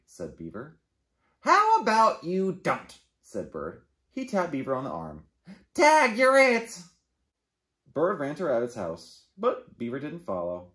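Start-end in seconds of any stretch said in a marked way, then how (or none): 1.4-3.0 high, loud, sassy bird voice; cheeky refusal. Acted. Bird sassing Beaver's story offer, cheeky. 5.7-6.8 high, loud, slow gleeful call; playful tag call. Acted. Bird tagging Beaver to play, gleeful.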